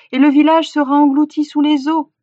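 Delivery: high, loud cry of doom; urgent warning. A narrator's reading. Hunter telling villagers of drowning village, urgently warning. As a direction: drive the danger home to them.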